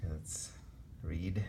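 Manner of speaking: normal talking voice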